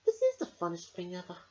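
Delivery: thinking happily; little girl voice. Acted. Emily's thought voiced.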